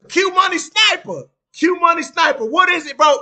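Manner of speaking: high, loud call to a chatter. Real, not acted. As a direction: hail him across the room. Calling out a chatter.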